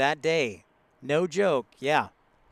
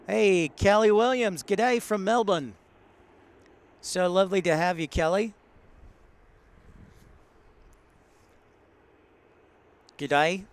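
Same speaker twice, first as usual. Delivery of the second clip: put-on Australian accent; 3 semitones higher than usual. Greeting viewer Kelly from Melbourne in a light Australian accent. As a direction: greet her like a cheerful Aussie, lightly put on. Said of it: "I'm trying to do my best Australian accent".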